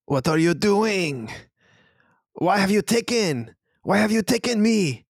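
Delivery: high-pitched character voice, stretched words; wholly other voice. Acted. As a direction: cry out as a bewildered captive. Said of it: "a wholly other voice in there".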